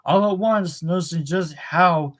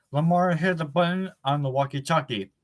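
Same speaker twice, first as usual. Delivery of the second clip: ordinary delivery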